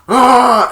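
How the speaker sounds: loud high war cry; battle yell. Retold or imitated. Spike charging Junior, loud war cry.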